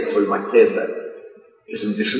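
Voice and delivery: normal talking voice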